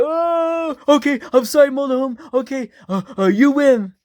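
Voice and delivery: high, frightened apology; scared pleading. Acted. Husband begging motorhome for mercy, high and scared. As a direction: beg the machine to stop.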